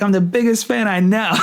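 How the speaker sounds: giddy, can't hold back the laugh; laughing brag. Retold or imitated. Telling caller he's a fan.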